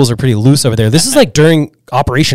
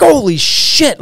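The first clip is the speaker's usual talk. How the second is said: high-pitched retold shock; spooked; 12 semitones higher, slower than usual. Snowboarder hears the car land, spooked. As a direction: reenact a startled curse at the noise. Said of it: "I remember being so spooked".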